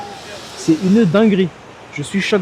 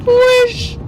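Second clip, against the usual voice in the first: surprised shout. Real, not acted. Sudden view of the sea.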